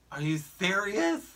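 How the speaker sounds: high, drawn-out mock disbelief; exasperated. Lady imitated after her license plate was bumped, exasperated. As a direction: imitate her incredulous, rising outburst. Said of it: "she was exasperated".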